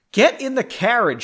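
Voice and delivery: high, loud command. Acted. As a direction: order him in, no arguing. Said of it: "she commanded".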